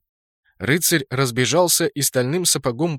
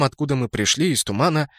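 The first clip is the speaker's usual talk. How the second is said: ordinary delivery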